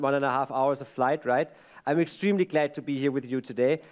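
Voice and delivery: ordinary talk, nothing marked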